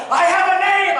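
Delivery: high, loud indignant declaration; imitated yelling. Drill instructor correcting cadet, high indignant yell. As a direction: insist on it like an offended instructor. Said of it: "he starts yelling at me".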